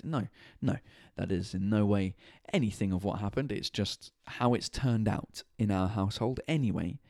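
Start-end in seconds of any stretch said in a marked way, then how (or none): none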